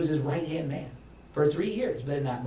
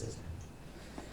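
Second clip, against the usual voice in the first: whispered, unvoiced; 19 dB quieter than usual. Real, not acted. Pastor asks the congregation, whispering. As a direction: murmur the question under the breath.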